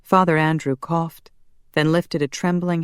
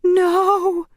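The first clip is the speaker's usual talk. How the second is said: very high, loud scream; 5 dB louder than usual. A narrator's reading. Corky sees Casimir turned to dust, screamed. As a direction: scream it out in horror. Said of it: "Corky screamed".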